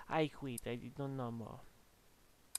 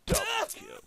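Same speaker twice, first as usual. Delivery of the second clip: much higher, loud hype call of "Double kill"; "Double kill" yelled high and loud; announcer shout; 4 dB louder, faster than usual. Pretend.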